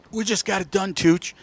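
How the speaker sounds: very soft, understated retold remark. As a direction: quote him quietly, almost under your breath. Retelling a laconic racer's words.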